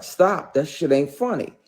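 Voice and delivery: high, loud retold warning; firm rebuke. Him grabbing her hand to stop her, indignant. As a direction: act out sharply telling someone off.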